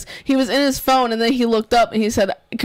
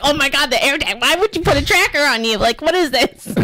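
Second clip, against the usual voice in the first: higher-pitched mock exasperation; lightly hammed-up outrage, pitched up; imitating freaking out; 5 semitones higher than usual. Daughter mimics dad over AirTags, mock freak-out. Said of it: "when you were freaking out about the AirTags".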